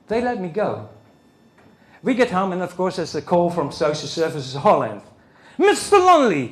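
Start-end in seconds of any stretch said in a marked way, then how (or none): 5.6-6.5 high, loud official voice; official greeting. Retold or imitated. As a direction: announce the name grandly. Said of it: "he says".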